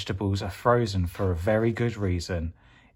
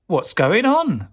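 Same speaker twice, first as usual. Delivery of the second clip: alarmed question; 7 semitones higher, 4 dB louder than usual. Acted. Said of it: "asked Aubergine".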